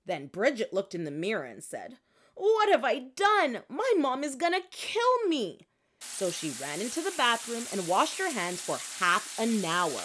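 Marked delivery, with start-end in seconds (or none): 2.3-5.7 put-on a child horrified at her reflection; panicked gasp. Child staring in the mirror, high panicked cry. Bridget sees herself in mirror.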